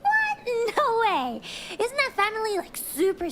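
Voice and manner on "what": high startled what; shocked squeak. Acted. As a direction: gasp it out in shock. Shocked at quitting rumor.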